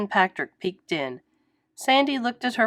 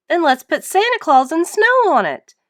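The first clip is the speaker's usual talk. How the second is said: bright, eager suggestion pitched up; excited Patrick; 8 semitones higher, 6 dB louder than usual. A narrator's reading. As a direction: pitch the idea with glee.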